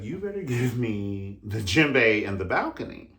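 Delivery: loud, playful demanding cry; excited demand. Retold or imitated. Wanting to dance in the balcony, shouting playfully. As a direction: call out wanting to join the show. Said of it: "I was like".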